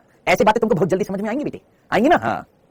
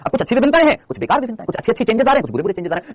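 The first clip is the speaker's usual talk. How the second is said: normal talking voice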